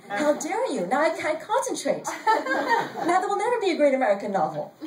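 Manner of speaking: high-pitched theatrical outrage, speeding up; hammed-up affront, rushing into the last complaint; mock indignation. A narrator's reading. Writer blaming seatmate for her lost novel, mock indignation.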